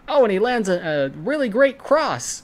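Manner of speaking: high, loud hyped announcer call; mock announcer. Podcaster mimicking the boxing announcer, loud and high. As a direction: ham up a boxing announcer's excitement. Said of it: "the announcer goes".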